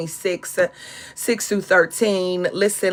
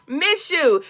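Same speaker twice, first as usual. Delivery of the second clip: talking to Ken; 9 semitones higher, 4 dB louder than usual. Retold or imitated. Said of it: "I just kept feeling like".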